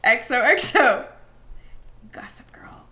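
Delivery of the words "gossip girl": hushed whisper. Real, not acted. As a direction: whisper the name aside. Whispered aside.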